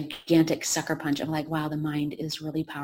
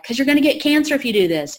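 high, loud alarmed objection; alarmed protest; 8 semitones higher, 8 dB louder, faster than usual. Retold or imitated. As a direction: blurt it out in alarm. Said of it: "people were like".

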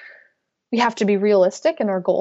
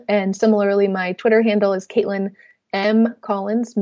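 ordinary delivery

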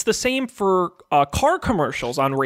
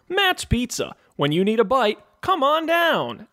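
mild radio ad voice; 5 semitones higher than usual. Retold or imitated. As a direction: sell the pizza like a sleepy local ad read, pitch higher. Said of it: "low-key, kinda, like, mild voice".

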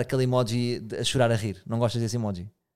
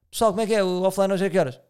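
loud, higher mock group call-out; brusque impression; 6 semitones higher, 5 dB louder than usual. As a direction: imitate a blunt colleague rallying everyone. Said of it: "É bruto, parece que é assim". (imitação bruta, alto e agudo)

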